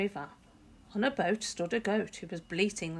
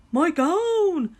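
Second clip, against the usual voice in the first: much higher, loud yell; joyful yell; 11 semitones higher, 12 dB louder than usual. A narrator's reading. George yells finding his old gown. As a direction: shout it with delight. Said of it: "he yelled".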